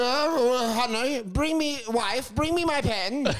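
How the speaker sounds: high, slow put-on aristocrat voice; hammed-up colonial husband calling his wife; pompous colonial gent.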